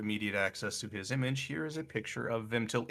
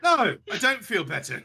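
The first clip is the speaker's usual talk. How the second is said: raised indignant denial; 10 semitones higher, 7 dB louder than usual. Acted. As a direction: snap back defensively. Character answers angrily.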